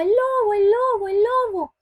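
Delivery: high, loud cry of wolf; shouting for help. Retold or imitated. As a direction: yell it like a scared shepherd boy calling the village. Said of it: "aunque Pedro gritaba". (gritando "¡el lobo!" a todo pulmón)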